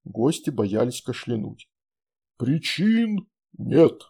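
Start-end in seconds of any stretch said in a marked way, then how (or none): 2.4-4.0 slow, pleased and content; satisfied. A narrator's reading. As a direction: savor the answer, satisfied. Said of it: "удовлетворённо кивнул Иерофант".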